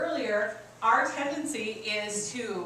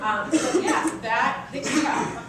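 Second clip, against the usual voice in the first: laughing; 2 semitones higher, 7 dB louder, slower than usual. Real, not acted. Laughing after the anecdote.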